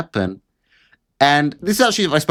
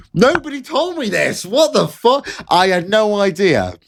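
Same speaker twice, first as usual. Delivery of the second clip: squeaky, much higher sarcastic alarm; mock outrage; 6 semitones higher than usual. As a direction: play the shocked innocent, up high. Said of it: "Colour me shocked".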